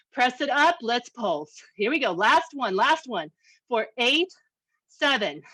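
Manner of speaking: usual speaking voice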